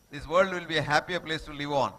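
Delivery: ordinary delivery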